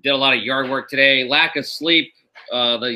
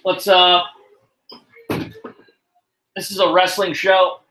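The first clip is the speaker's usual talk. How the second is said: raised voice toward the other room; calling out; 4 semitones higher than usual. Real, not acted. Calling to crying kid offscreen.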